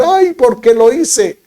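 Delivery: mock remorse. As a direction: groan over what you did. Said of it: "sensación emocional de decir".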